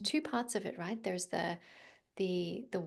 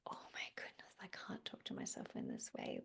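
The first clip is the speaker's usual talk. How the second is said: hushed, self-conscious mock inner voice, much softer; embarrassed whisper; 10 dB quieter than usual. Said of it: "I was like".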